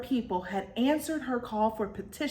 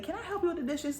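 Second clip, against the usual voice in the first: higher, softer eager offer; sweet polite offer; 10 semitones higher, 4 dB quieter, faster than usual. Retold or imitated. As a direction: sweetly offer help, pitched up. Belle offers help to Missus Potts.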